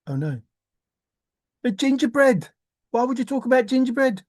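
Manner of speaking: ordinary delivery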